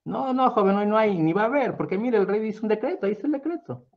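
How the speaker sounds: high, fussy voice; a lightly voiced old neighbor; older neighbor. A narrator's reading. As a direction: explain the decree to the young man. Neighbor tells of the decree.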